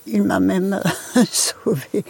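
a small laugh breaking into the line; slow, amused, with a chuckle before the last word. Real, not acted. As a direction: let a laugh slip out mid-sentence. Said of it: "Je ris".

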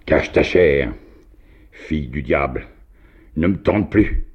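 loud, high outburst pushing her away; crying out. A narrator's reading. Old man drives off the tempting girl, shouting. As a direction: shout her off, rising on the last words. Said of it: "en criant".

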